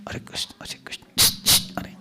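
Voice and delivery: hissed, whispered chant; breathy hissing mumble of the mantra. Shown as a demonstration. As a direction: hiss the mantra like a snake. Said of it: "they hiss like a snake".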